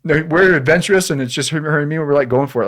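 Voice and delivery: defending wife and himself, high and loud; eager defense. Real, not acted. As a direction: insist on the adventure. Defending their trip.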